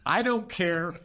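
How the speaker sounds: normal talking voice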